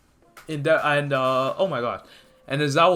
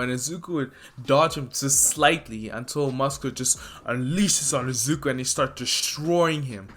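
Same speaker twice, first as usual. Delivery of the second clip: ordinary talk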